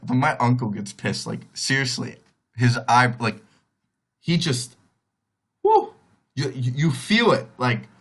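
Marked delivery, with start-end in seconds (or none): 5.6-5.9 sudden loud, high-pitched whoop; sharp high whoo. Retold or imitated. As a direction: let out a quick, piercing "whoo" for impact. Imitates the uncle's angry impact.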